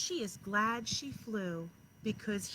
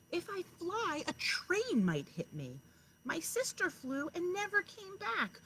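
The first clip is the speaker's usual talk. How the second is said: higher, pleading little chick voice; frightened chick; 7 semitones higher, 3 dB louder, slower than usual. A narrator's reading. Last chick pleading with her parents, higher and pleading. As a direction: list the fears like a worried child. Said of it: "said the last chick".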